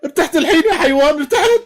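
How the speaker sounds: shrill-high furious shout at the seller; angry outburst. Acted. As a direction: snap at him in rising fury. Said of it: "قالت له البنت بعصبية". (بنت تصرخ بعصبية على البائع)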